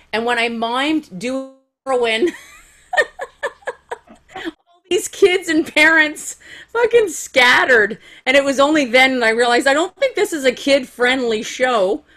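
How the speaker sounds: laughing through the words. Real, not acted. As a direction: tell it while cracking up. Laughing through the heroin story.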